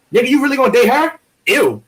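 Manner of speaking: high, fast, loud disbelief; hammed-up comic best friend butting in; rom-com sidekick. Friend judging his buddy's date in a rom-com, hammed-up disbelief.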